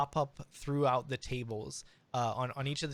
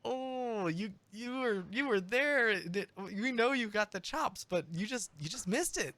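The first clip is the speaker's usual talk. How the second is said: much higher, consoling crowd voice; sympathetic crowd; 9 semitones higher than usual. Acted. Crowd consoling the singer after his cracked note, sympathetic. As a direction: comfort him gently, up high. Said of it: "but it's just like that".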